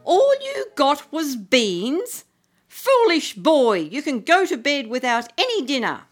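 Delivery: cross mother berating Jack; scolding mother. Acted. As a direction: Foolish boy, sharply scolded. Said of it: "His mother said".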